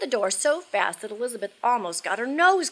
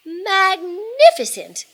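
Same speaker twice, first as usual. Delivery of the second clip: high, loud, drawn-out exclamation; big gushing "wow" delivery; impressed; 10 semitones higher, 9 dB louder, much slower than usual. A narrator's reading. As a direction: marvel out loud, stretching the word. Elizabeth flattering the dragon.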